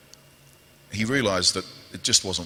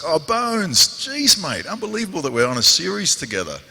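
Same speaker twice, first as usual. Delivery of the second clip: excited greeting; 8 semitones higher than usual. Retold or imitated. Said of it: "and I was like".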